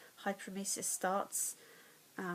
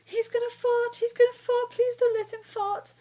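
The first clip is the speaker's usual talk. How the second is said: very high, much louder panicked squeal; 12 dB louder than usual. Retold or imitated. To her brother about his dog, a high panicked squeal. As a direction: shriek it like she's terrified of the dog. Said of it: "I'm like".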